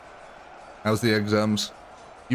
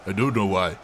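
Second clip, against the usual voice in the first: put-on African accent in a slightly deeper voice; 3 semitones lower than usual. Shown as a demonstration. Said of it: "go deep when I do this".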